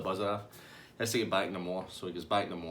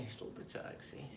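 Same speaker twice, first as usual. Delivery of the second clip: voiceless, much softer echo; whispered plea; 15 dB quieter, slower than usual. Retold or imitated. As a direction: repeat the call as a whisper. Dave repeats his plea to driver.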